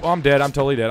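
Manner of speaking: ordinary talk, nothing marked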